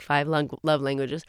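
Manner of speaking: normal talking voice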